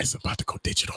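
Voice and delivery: conspiratorial whisper; whispered aside. Acted. Host teasing the listeners in a whisper. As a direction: whisper it like a secret.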